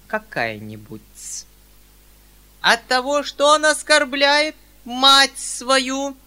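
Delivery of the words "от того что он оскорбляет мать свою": very high, loud furious mother; menacing. A narrator's reading. General's wife denounces her son, menacing and loud. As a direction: thunder at him. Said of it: "грозно проговорила".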